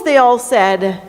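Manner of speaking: ordinary delivery, nothing marked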